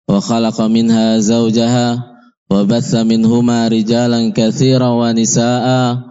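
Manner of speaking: ordinary delivery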